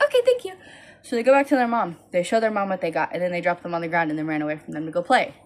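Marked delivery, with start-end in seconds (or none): 0.0-0.5 put-on very high chirpy little girl. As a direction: chirp thanks like a small child. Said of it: "they're like".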